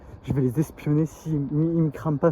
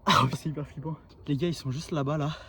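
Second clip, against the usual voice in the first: while running; 5 semitones lower, 4 dB quieter than usual. Real, not acted. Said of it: "je suis en train de courir".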